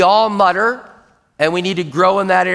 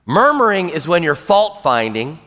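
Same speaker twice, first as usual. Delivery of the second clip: ordinary delivery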